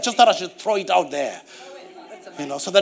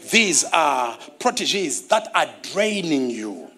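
slow recap; 5 semitones lower than usual. Real, not acted. Slow low recap of points.

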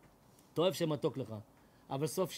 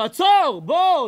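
frantic shout; 10 semitones higher, 15 dB louder, much slower than usual. Pretend. Yelling to a friend about to step before a speeding truck, frantic shout. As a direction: yell stop at the top of your voice.

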